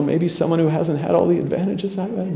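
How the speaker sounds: nervous voice cracking up high; voice breaking. Retold or imitated. Nineteen-year-old asking his hero Vonnegut for advice, voice cracking. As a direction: let the voice crack upward as nerves take over, then trail off. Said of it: "my voice starts to go".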